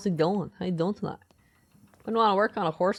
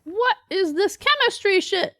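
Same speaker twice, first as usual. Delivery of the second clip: much higher and louder, a slowed mock squeal; squeaky outburst; 13 semitones higher, 6 dB louder than usual. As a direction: shriek it like a cartoon character overwhelmed. Confronted by the soil chart.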